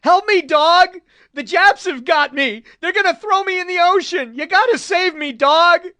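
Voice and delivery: high, loud, frantic begging; raving Marine begging a Doberman to save him; pleading in terror. A narrator's reading. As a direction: plead wildly with the dog for rescue. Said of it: "he pleaded to the Doberman".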